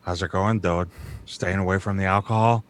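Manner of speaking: rough East Coast tough guy voice, low. Shown as a demonstration. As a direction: growl it like a mafia tough. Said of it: "it's this rough thing".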